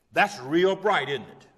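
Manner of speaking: mock-admire a silly idea loudly; pointed, sarcastic compliment; sarcastic praise. Real, not acted. Sarcastic verdict on the excuse.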